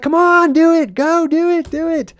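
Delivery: very high, loud squeaky begging; desperate high pleading push; pleading urge. Retold or imitated. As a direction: Sam begging Frodo to drop the ring. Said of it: "He's like".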